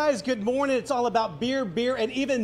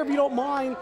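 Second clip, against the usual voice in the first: ordinary delivery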